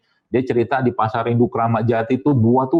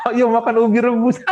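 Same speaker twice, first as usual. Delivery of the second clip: mock coaxing parent; 8 semitones higher than usual. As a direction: coax a fussy kid to eat boiled banana. Parent coaxing kids to eat.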